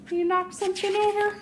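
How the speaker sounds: ordinary delivery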